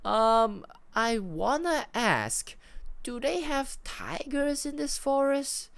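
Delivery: high-pitched, drawn-out wondering; hesitant question. Acted. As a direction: ask slowly and warily, voice pitched right up. Acted hesitant question over walkie-talkie.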